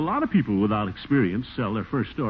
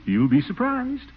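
coy tease; 13 semitones higher, slower than usual. Acted. Teasing his secret news.

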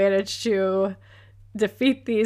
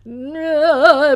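loud no; 6 semitones higher, 6 dB louder than usual. Retold or imitated. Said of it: "the alien head Oz is all".

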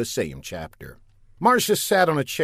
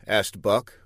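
usual speaking voice

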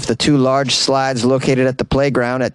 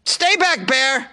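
high-pitched panicked cry at a bear; panicked yell; 11 semitones higher, much slower than usual. Pretend. Someone mistaking the slide for a bear, panicked high yell. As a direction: shriek at the imaginary bear to stay back. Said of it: "was like".

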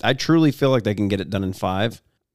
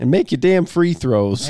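damn stressed, higher and louder; emphatic; 6 semitones higher, 4 dB louder than usual. Acted. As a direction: lean hard on damn. Echoing the catchphrase loudly.

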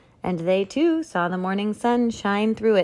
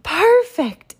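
high delighted cry of praise; exclaiming; 12 semitones higher, 8 dB louder than usual. Acted. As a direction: gush it out, pitched way up. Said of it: "exclaimed the Duchess".

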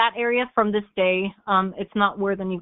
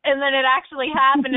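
ordinary delivery

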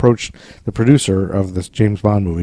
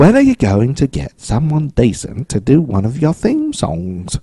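bad British accent; 4 semitones higher than usual. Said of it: "That's British".

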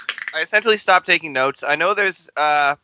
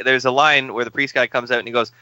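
normal talking voice

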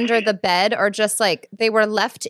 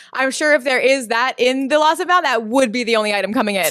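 loud, laughing retold quote; amused mimicry bursting with laughter; laughing imitation; 4 semitones higher, 6 dB louder, faster than usual. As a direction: quote the clerk while cracking up. Retelling the clerk while cracking up.

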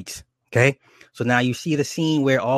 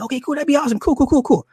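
eager nerdy guy; 14 semitones higher, faster than usual. Retold or imitated. Chris answers Cynthia's invitation, high and giddy. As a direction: squeak out an overexcited yes.

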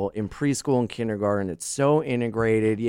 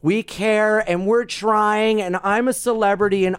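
mocking celebrity; 9 semitones higher than usual. Mocking a phony celebrity raising money. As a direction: sneer like a fake liberal star. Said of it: "you know".

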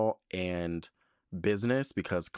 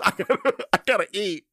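laughing; 11 semitones higher, 7 dB louder than usual. Retold or imitated. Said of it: "And just was like".

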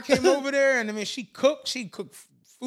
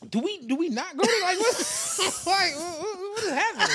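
high, laughing bewildered question; laughing and confused at his girl; laughing confusion; 9 semitones higher than usual. Retold or imitated. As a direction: stammer through it, laughing in disbelief. Retold confusion at the bar.

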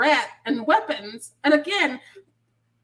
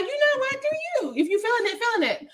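mock encouraging; 10 semitones higher, faster than usual. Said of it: "And I'm like".

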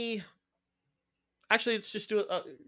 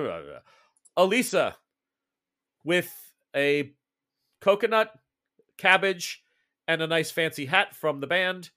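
usual speaking voice

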